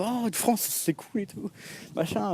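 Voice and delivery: high, hushed imitation of an impressed listener; imitated delighted "oh", airy and high; mock impressed reaction. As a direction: coo it softly, way up high. Mimics foreigners' delighted reaction to France.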